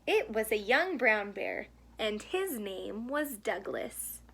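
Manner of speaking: gentle storybook read-aloud; reading to children. A narrator's reading. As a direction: read it like a bedtime picture book. Reads picture book to children.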